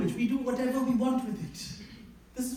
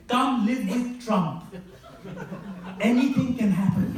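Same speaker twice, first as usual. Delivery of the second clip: mock ad voice; slower than usual. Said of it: "the ads for that say".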